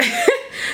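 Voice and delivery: a short, very high burst of laughter; sudden high laugh. Real, not acted. Tired reader laughing at her own yawning, high giggle. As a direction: laugh at yourself, caught off guard.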